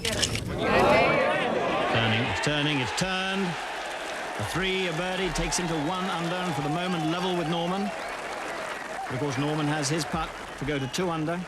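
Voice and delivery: live golf play-by-play; golf commentator. Real, not acted. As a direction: call the playoff putts as they drop.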